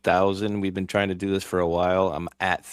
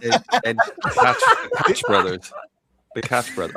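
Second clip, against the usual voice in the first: squeaking through laughter; laughing while saying it; 4 dB louder than usual. Real, not acted. Laughing at Koch misreading.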